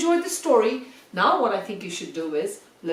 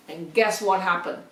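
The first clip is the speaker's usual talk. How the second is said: ordinary delivery, nothing marked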